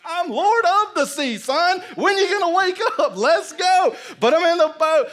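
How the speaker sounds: high, very loud whooping shout; exultant holler. Acted. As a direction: holler gleefully over the chaos. Jesus exults, hollering at Peter.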